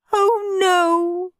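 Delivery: high, long-held moan of dismay; mum's drawn-out, high lament; despairing wail. A narrator's reading. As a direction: wail it as all hope goes. Mum despairing before tears.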